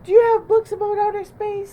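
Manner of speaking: high, loud, fast bird voice; eager Bird. A narrator's reading. Bird asks Bunny about space books, high, fast and loud. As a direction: chirp it out quickly and excited. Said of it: "said Bird".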